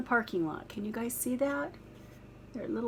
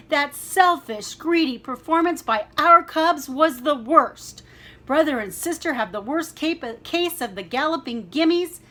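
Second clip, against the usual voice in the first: much louder, higher furious father; angry roar; 5 semitones higher, 8 dB louder than usual. Acted. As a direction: bellow the scolding at full force. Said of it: "he roared".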